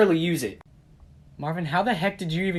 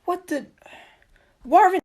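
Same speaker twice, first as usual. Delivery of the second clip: high, loud jolt of surprise; shocked shout; 5 dB louder than usual. Acted. Friend startled by Marvin appearing, high shocked yell. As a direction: shriek his name in shock.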